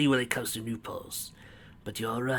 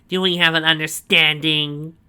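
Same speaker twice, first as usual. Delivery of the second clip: proud villain boast; 5 semitones higher, 11 dB louder than usual. A narrator's reading. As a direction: savour every word of the boast. Snively's voice.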